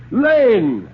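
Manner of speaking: sharp, high call for the butler. Acted. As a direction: snap the servant's name out high. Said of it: "said Sir William sharply".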